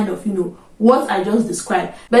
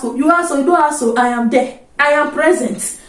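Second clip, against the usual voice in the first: ordinary talk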